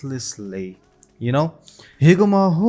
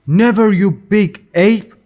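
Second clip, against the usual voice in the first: high, loud insult; defiant shout; 8 semitones higher, 8 dB louder than usual. A narrator's reading. Luffy defies Higuma who holds him down, defiant shout. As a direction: throw the refusal out loudly.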